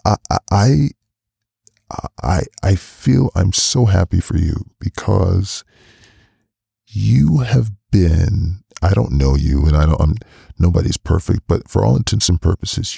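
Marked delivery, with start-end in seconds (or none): 0.1-8.6 choked up. Real, not acted. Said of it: "why am I getting emotional".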